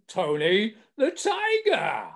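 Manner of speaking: booming cartoon tiger voice; booming Tony the Tiger. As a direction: announce the name like the TV tiger. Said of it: "And it was the voice".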